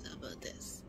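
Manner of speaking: voiceless whisper. Shown as a demonstration. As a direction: breathe it, no voice. Repeats, getting quieter.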